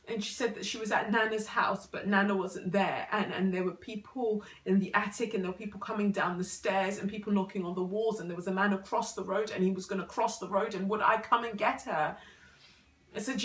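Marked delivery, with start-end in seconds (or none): 0.7-12.2 louder, tumbling urgency; urgent retelling. Acted. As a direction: pour it out in one anxious run. Mother relays dead child's plea.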